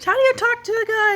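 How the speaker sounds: hammed-up helpless question; clueless squeak. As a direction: squeak it out like a lost teen. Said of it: "I was like".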